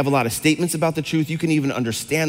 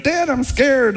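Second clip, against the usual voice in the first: put-on scared little boy; 10 semitones higher, much slower than usual. Son voiced in dad's story.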